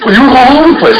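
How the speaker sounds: high, loud mock exclaim; mock amazed onlooker. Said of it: "And he goes".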